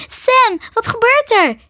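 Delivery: high, loud frightened call to Sam. A narrator's reading. Maree trapped in the walls, calling out to Sam in fear. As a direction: call out to Sam through the wall, scared. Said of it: "riep ze".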